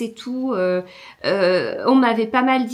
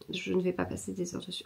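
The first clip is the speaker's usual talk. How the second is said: much softer, half under her breath; muttered to herself; 3 semitones lower, 12 dB quieter, faster than usual. Real, not acted. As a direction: repeat it quietly as a resolution to yourself. Repeats the line to herself.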